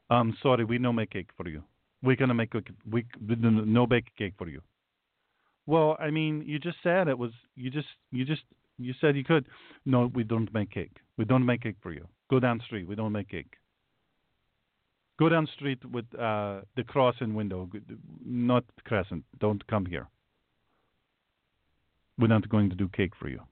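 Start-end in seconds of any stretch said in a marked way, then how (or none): 0.1-4.6 put-on lower, broken-English baker voice with a foreign accent; a lightly accented baker taking an order. Baker answers the customer, lower broken-English accent. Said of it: "the guy goes". 9.9-23.4 put-on lower, broken-English refusal with a foreign accent; a lightly accented baker turning the customer away. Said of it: "the guy goes".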